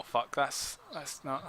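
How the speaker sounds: ordinary talk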